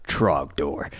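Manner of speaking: low dramatic Trogdor; deep voice. Pretend. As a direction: drop the voice for the name. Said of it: "lower your voice an octave when you say Trogdor".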